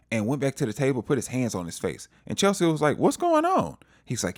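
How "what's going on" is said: very high-pitched retold question; acted alarmed question. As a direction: imitate her asking in a high voice. Acted worried question from Chelsea.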